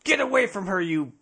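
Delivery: high-pitched movie-quote yell, hammed up; mock yell. Girl yelling at someone playing with her dolly, mock yell. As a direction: yell it high, as a playful threat. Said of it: "she can, uh, yell at them".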